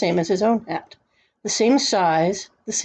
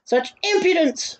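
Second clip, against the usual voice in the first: high-pitched outrage, no real volume; indignant shout; 8 semitones higher than usual. A narrator's reading. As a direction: exclaim like an affronted king. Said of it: "shouted the king".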